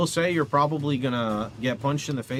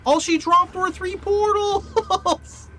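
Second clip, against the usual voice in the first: much higher, slow, loud disbelief; exasperated outburst; 5 dB louder, much slower than usual. Real, not acted. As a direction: drag it out in outraged disbelief. Bad loot after boss.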